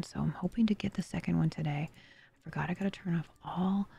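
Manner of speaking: breathy hushed whisper. Real, not acted. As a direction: keep the whisper going. Keeps whispering during game.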